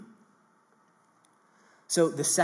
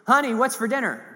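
raised call, higher and louder; husband shouting to his wife from the door; 8 semitones higher, 5 dB louder than usual. Retold or imitated. Husband calling from kitchen.

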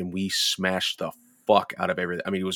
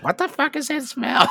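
high-pitched retold yelp of disgust; mock horrified question, pitched way up; appalled outcry; 3 dB louder than usual. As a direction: squeal it as the offended housemate. Retold complaint about a bad smell.

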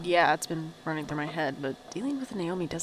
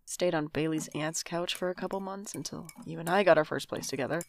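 ordinary talk, nothing marked

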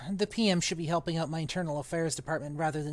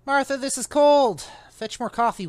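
louder, higher, bossy complaint; imperious order; 7 semitones higher, 7 dB louder than usual. Acted. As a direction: bark at the wife over cold coffee. Husband orders wife about coffee.

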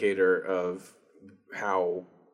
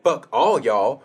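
mock defiant drawl; 3 semitones higher, 8 dB louder, slower than usual. Mocking character's exit line.

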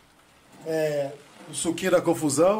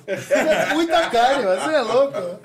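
excited; 4 semitones higher, 5 dB louder than usual. Real, not acted. Amazed by huge portion.

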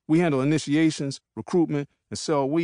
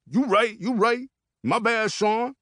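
backing down; 9 semitones higher than usual. Acted. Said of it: "Peanut says with his hands up".

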